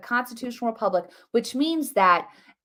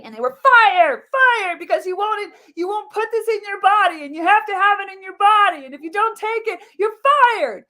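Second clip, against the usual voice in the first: outraged; 9 semitones higher, 6 dB louder than usual. Real, not acted. Outraged account of firings.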